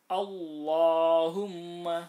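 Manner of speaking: Allahumma, slow and loud, with a thick, heavy lam. Shown as a demonstration. Teacher demonstrating the thick lam, slow and loud. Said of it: "lamnya harus dibaca tebal".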